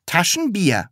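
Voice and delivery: proud. Acted. Pupil telling teacher the surname, proud. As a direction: announce the name with pride. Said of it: "sagte das Sams stolz".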